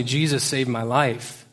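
ordinary delivery

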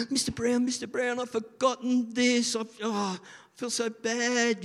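put-on high, fretful seven-year-old pleading with his teacher; anxious little boy. Boy tells his teacher he forgot his books, high and fretful.

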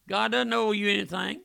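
ordinary talk, nothing marked

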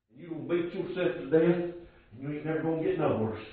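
low, very soft sermon delivery; quiet, low pulpit warning with long pauses; hushed preaching. Real, not acted. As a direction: drop right down and confide it to the congregation. Preacher drops to hushed aside.

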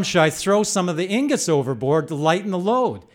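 loud, high yell over wind and engine noise; yelling over the storm. Retold or imitated. Narrator yelling to Jack over the roaring surf, loud and high. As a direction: shout the question across the boat. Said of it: "so I yell to him".

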